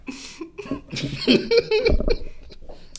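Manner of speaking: breathless unvoiced wheezing; real silent laughing fit; silent wheeze laugh. Real, not acted. Laughing at taco joke.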